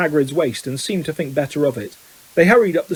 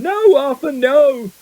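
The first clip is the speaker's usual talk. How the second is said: high-pitched shriek of alarm; 10 semitones higher, 4 dB louder, slower than usual. A narrator's reading. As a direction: shriek high, pleading to stop. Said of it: "Missus Weasley was shrieking".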